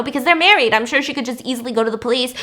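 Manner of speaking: plain reading voice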